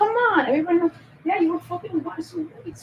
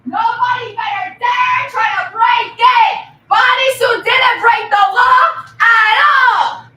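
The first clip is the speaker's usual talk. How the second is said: loud, slow warning shout; 3 semitones higher, 7 dB louder, slower than usual. Real, not acted. Warning the chat with a loud shout. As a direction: warn them off at full volume.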